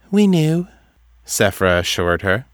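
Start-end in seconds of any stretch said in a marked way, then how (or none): 0.1-0.7 high, detached oracle voice; distant. A narrator's reading. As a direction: pitch it high and far away, as if dreaming.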